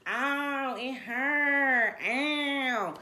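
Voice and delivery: high, hammed-up whine of pain; mock dramatic wail. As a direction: mimic over-dramatic women crying ow. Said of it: "they was acting so dramatic".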